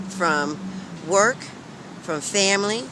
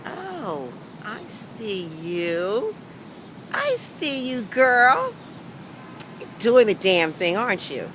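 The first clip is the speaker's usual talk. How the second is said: sassy self-praise; 3 semitones higher, slower than usual. Retold or imitated. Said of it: "I said".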